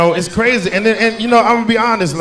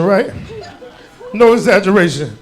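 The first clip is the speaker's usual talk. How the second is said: ordinary talk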